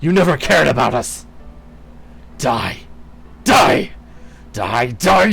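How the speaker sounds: loud, high raging shouts; furious tirade. Acted. Woodwose beating the queen, raging loud shouts. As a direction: scream every line in betrayed fury. Enraged tirade while attacking.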